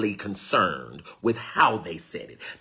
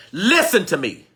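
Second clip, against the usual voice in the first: urgent call; 8 semitones higher, 6 dB louder than usual. Real, not acted. Preacher demanding attention.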